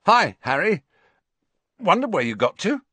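high, loud greeting with awkward bravado. A narrator's reading. Ron greets Harry while avoiding Hermione, awkward bravado. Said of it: "an odd mixture of bravado and awkwardness".

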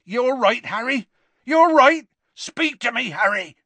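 high, loud calling out in alarm; frantic Hagrid. A narrator's reading. Hagrid calling to dazed Harry on the ground, alarmed. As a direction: shout to wake the fallen boy.

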